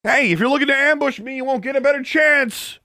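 high-pitched angry yell; angry shout. A narrator's reading. Alexandra challenges whoever lurks in the darkness, angry shout. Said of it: "Angrily, she shouted into the darkness".

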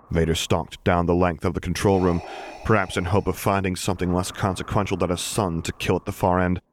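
usual speaking voice

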